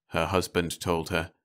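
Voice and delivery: usual speaking voice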